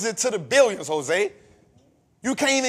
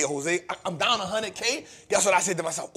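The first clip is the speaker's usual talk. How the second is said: ordinary talk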